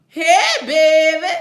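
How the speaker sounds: loud, high, drawn-out New Orleans grandma; grandma impression. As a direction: imitate a grandma's big, slow greeting. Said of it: "That's how she talk".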